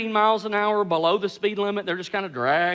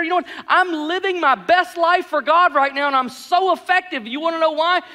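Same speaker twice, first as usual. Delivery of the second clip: high-pitched, ironic delight; hammed-up happy testimony in a raised pitch; mock cheerful boasting; 6 semitones higher than usual. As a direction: brag brightly about something absurd, tongue in cheek. Ironic boast about being bitter.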